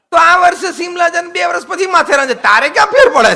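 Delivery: high, loud scolding burst; loud rhetorical shout. Real, not acted. Preacher to stubborn husband, high loud shout. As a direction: hammer the point home with a shout.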